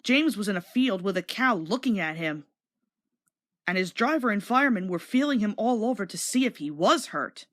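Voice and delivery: ordinary delivery, nothing marked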